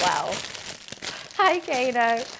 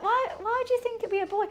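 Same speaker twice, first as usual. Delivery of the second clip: puzzled question; 9 semitones higher, 4 dB quieter, faster than usual. Retold or imitated. Retold puzzled question to the neighbour.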